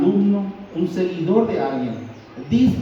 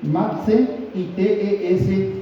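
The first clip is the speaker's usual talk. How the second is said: slow spelling out; much slower than usual. Shown as a demonstration. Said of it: "para los que están anotando".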